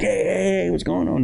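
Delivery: high cheerful party greeting; cousins greeting. Retold or imitated. Cousins greeting the boy in the club, high and cheerful. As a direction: call out like happy cousins.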